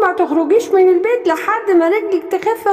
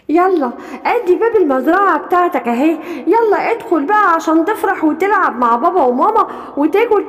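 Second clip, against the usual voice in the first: lower, calm comforting voice; gentle reassuring lion; 5 semitones lower than usual. Acted. Lion comforting the lost little one, lower and gentle. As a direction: soothe the crying little one.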